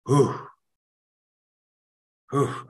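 normal talking voice

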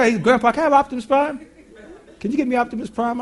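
a hint of an eager four-year-old; child asking. Grandson asking grandpa for a toy, lightly voiced.

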